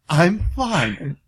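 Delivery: dropping low, each word measured; imitated deliberate calm. Yelling drops to forced calm.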